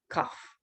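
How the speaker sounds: teacher contrasts "cough" said as "cuff". Shown as a demonstration. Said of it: "you can definitely hear the difference".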